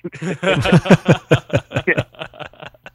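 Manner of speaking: loud high laugh at his own story; laughing at his own bad luck; big laugh. Real, not acted. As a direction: burst out laughing.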